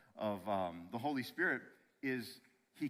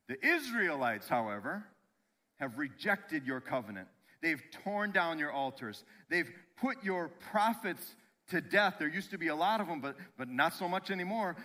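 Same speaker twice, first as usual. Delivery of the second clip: louder, raised-pitch grievance, hitting the key words; indignant complaint; 3 semitones higher, 4 dB louder than usual. Acted. Elijah accusing the Israelites before God, indignant and emphatic. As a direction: plead your case against the others with heavy emphasis.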